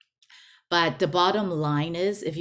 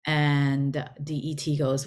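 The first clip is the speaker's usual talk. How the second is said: usual speaking voice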